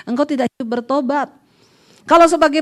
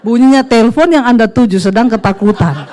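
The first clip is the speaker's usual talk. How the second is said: louder, laughing mock operator announcement; mock phone operator; 3 semitones lower, 8 dB louder than usual. Preacher jokes to the congregation as a phone operator, laughing and loud. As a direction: parody the recorded phone message.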